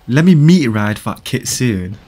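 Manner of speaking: ordinary talk, nothing marked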